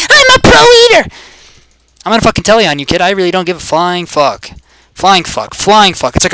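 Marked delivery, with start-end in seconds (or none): 0.1-1.1 very high, loud screech; screamed boast. Pretend. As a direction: scream it at the top of your range. Boasting yell at opponent.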